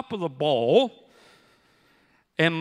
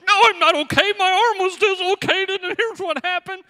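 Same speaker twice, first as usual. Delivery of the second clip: much higher, louder whiny confession; mock wail; 11 semitones higher, 7 dB louder than usual. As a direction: blurt out the truth in a high wail. Admitting to wife he's hurt.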